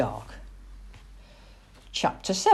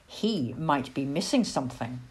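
ordinary delivery, nothing marked

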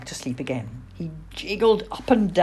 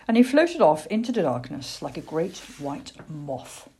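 usual speaking voice

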